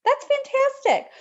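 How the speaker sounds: high-pitched, thrilled exclamation; real delight. Real, not acted. Host cheering a cruiser's lucky dining booking, delighted.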